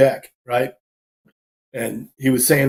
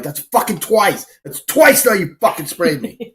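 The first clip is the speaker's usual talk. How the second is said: higher, slow, exasperated complaint; retold outrage; 6 semitones higher than usual. Bouncer snapping at his partner for spraying him twice, outraged. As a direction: spit it out like you can't believe it happened again.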